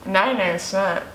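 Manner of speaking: eating between words; talking while chewing. Real, not acted. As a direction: mouth full of donut. Seen 0: Woman holds donut at mouth, apparently chewing; unclear who speaks.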